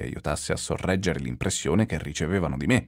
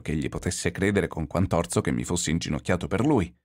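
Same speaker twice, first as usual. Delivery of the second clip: ordinary delivery, nothing marked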